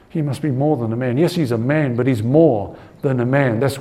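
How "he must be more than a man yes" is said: ordinary talk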